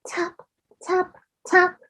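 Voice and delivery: high, light tapping sounds. A narrator's reading. Reading to a child, the big egg hatching, high tapping. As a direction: tap out the egg cracking like a tiny beak.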